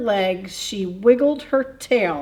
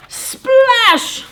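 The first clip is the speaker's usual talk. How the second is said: high, loud sound-effect shout; loud splash; 10 dB louder than usual. A narrator's reading. Bird hits the water.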